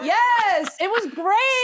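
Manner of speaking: high, loud camp cheering; sassy reindeer. Acted. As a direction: hype each other up like drag queens. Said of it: "Two reindeer quietly trash-talking Santa".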